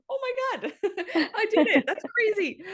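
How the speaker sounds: high, breathless with laughter and disbelief; amazed and laughing. Retold or imitated. Mother meeting her newborn, laughing in amazed disbelief. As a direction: gush it out laughing in shock. Her reaction as baby arrives.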